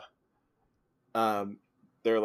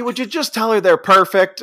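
pleading Ed voice; 9 semitones higher, 8 dB louder than usual. Retold or imitated. Retelling Ed's pleading line.

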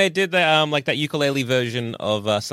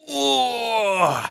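put-on old man groan; 10 semitones higher than usual. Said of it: "Shrek will slump into a chair".

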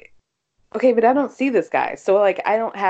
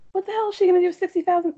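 shocked disbelief; 10 semitones higher, much faster than usual. Real, not acted. Shocked at low salary.

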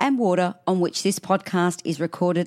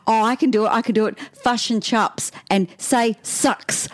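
Aussie mimicking Kiwi; 4 semitones higher than usual. Aussie showing off fush and chups, mock Kiwi accent. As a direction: exaggerate Kiwi vowels, slightly raised pitch. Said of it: "how's the Aussies that go".

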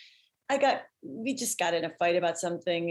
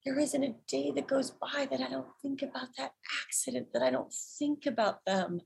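higher and softer, a hushed grieving confession; imitating her dad; 6 semitones higher, 4 dB quieter than usual. Dad confessing guilt over the accident to his daughter, soft and higher. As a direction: let the regret come through quietly. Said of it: "he goes".

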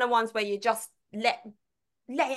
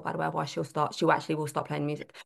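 usual speaking voice

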